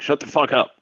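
put-on Spanish accent, snapped. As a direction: snap it back in a Spanish accent. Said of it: "And I was like".

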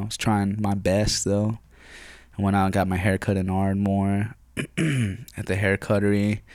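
normal talking voice

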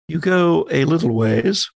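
normal talking voice

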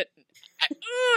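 high, far-off mock whine; a hammed-up cry of dismay; mock whimper. Imitating David flinching from the fire, mock whimper.

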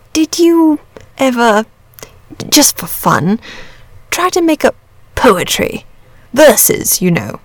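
hesitant, halting question with long pauses; lightly shy dragon, feeling its way to the word; bashful. A narrator's reading. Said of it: "he said bashfully".

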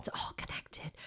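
unvoiced conspiratorial whisper. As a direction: whisper it like a secret plot. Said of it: "conspiracy joke, whispered".

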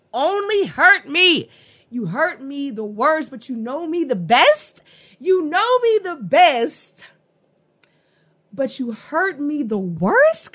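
high, loud, incredulous emphasis; worked up. Real, not acted. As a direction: get carried away and belt out the key words. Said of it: "I keep getting excited".

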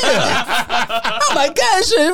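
high, laughing goofy voice; laughing silly imitation, way up high; mocking drunk friend. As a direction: ape him while cracking up.

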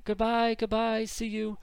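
high cheerful goodbye; Martha voice. Acted. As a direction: wave them off brightly. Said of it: "She's like".

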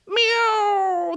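high drawn-out meow; cat meow. A narrator's reading. Cats warning Harriet, a high long meow. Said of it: "they said".

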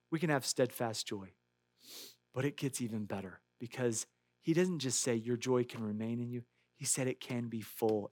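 soft, low, intimate preaching voice; hushed preaching. Real, not acted. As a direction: draw them in with a near whisper. Sermon drops to near-whisper.

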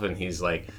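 ordinary delivery

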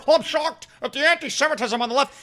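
quick mock-shocked anchor; sarcastic fake shock; lightly voiced pompous newsman; mocking Jake Tapper. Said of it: "has Jake Tapper".

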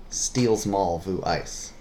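slow "Steals mall vu ice", run together in one phrase; joined-up clue repeat. Shown as a demonstration. Repeating the puzzle clue for a guessing partner, words joined up.

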